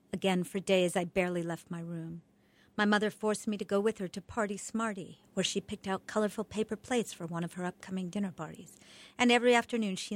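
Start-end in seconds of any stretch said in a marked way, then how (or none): none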